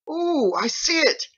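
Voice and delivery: very high, slow delighted wonder; a first look through a scope; amazed. Acted.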